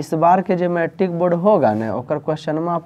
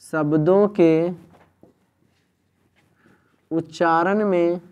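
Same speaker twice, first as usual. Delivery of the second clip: measured phrases with long pauses; slow dictation; 3 semitones higher, slower than usual. Real, not acted. Teacher dictates the definition of Sangam, slow dictation. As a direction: dictate in small pieces, waiting between them.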